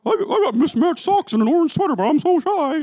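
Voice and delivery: very high, loud caricature of a shy self; self-impression. Guest doing an impression of herself, exaggerated shy voice. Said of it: "That's my impression of me".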